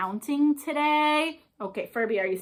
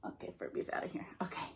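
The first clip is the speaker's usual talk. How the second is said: whisper; 16 dB quieter, much faster than usual. Real, not acted. Aside while dog leaves.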